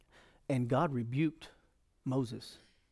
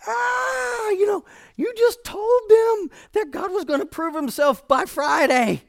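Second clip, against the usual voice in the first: high, hysterical shriek of accusation; frantic, full-volume acted freak-out; screaming inner panic; 8 dB louder, slower than usual. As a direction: scream it in alarm from the first cry. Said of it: "Everything's screaming in me".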